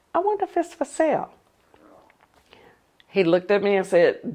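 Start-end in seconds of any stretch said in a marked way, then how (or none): 0.1-1.3 sweet coaxing. Retold or imitated. Wife coaxing husband about house.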